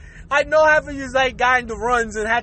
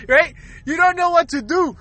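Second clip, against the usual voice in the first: ordinary delivery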